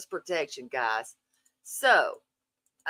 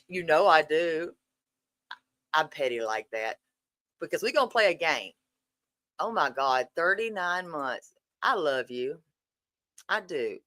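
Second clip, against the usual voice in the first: normal talking voice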